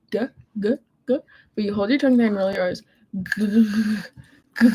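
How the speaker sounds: g sound rolled back in the throat; guttural French R. Shown as a demonstration. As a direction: hold the tongue and roll g, g, g. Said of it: "pronouncing the G like g".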